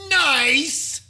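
high, sarcastic "Nice"; drawn-out smug "Nice"; mock Trump. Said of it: "That's how he ended that tweet".

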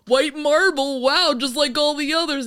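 hammed-up boastful American guide; mock eager tour guide. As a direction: push the monuments on him like a needy host.

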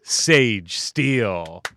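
loud, slowly stretched "Sage Steele"; announcer intro. Acted. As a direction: draw the name out like an arena announcer. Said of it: "that intro is amazing".

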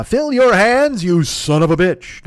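slow, loud drawled cowboy line; John Wayne line. Retold or imitated. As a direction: growl it like an old western hero. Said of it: "Not bad for a one-eyed fat man".

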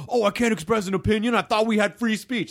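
high, fast sarcastic whiny commenter; mock the offended troll. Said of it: "it's like".

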